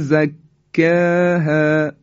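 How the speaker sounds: slow drawn recitation of زکاها with hidden nasal; Quran recitation. Shown as a demonstration. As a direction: recite زکاها slowly, hiding the nun.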